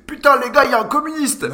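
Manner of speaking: high, loud shout from the back of the room; mocking schoolboy holler; classmate yelling. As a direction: announce it to the whole class like a scandal. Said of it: "Et lui, au fond de la classe".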